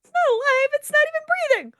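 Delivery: very high squeaky parody; mocking squeaky voice. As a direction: parrot the line in a silly voice. Mocks the line just read.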